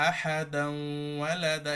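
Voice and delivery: আহাদান ওয়ালাদা with the nun sounded; tanween kept. Shown as a demonstration. Teacher recites with tanween kept. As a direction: carry the nun of আহাদান through. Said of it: "তানবিন দিয়ে পড়তে হবে".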